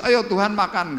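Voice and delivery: lively mock invitation to eat; eager invitation. Acting Matius inviting Jesus.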